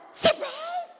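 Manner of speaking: high, startled cry of surprise; surprised shriek. Shown as a demonstration. Teacher acts out surprise for the class, high startled cry. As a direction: yelp it like you're caught off guard. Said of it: "let's say it with emotion".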